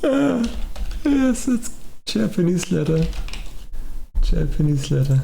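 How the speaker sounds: high, stiff parody of a text-to-speech voice; mimicking the synthetic voice, pitched far up; mocking robot voice. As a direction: ape the machine's flat robotic read. Mocking the TTS output.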